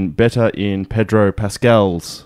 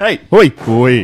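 silly loud call; 2 semitones higher, 6 dB louder, much slower than usual. Pretend.

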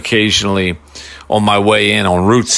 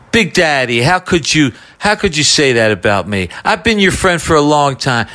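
lightly voiced, higher, wounded friend; Pat Croce impression; 5 semitones higher than usual. Prank caller playing a hurt friend on air, lightly voiced. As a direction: play a hurt friend calling in to complain. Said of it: "And I start doing Croce".

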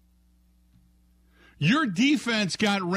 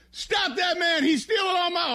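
higher hammed-up cry for help; higher mock alarm; mock shout; 10 semitones higher than usual. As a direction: yell after the thief. Coach chasing the thief.

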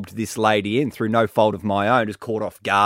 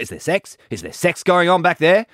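pointed questioning; 10 semitones higher, 3 dB louder than usual. Retold or imitated. Councilman quizzes him in retold exchange.

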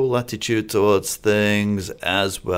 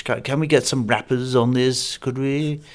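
lightly voiced board member, higher at the end; a hint of a committee member asking for rappers; out-of-touch board member; 5 semitones higher than usual. Board member deciding what young people want, lightly mocked. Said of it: "a bunch of board members deciding".

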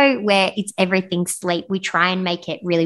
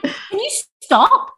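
laughing plea; 13 semitones higher, slower than usual. Real, not acted.

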